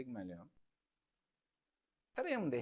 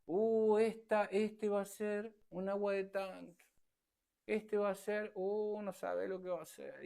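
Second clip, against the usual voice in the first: higher, slow teasing aunt voice; mocking relative; 6 semitones higher, slower than usual. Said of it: "Nacía sin llorar".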